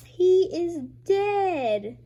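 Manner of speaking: slow dramatic announcement. Acted. Reading comic dialogue.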